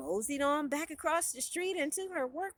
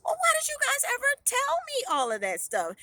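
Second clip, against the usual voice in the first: high, exasperated retort; indignant protest; 11 semitones higher, 3 dB louder, faster than usual. A narrator's reading. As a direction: throw the question back at them. Heroine indignantly retorts to family.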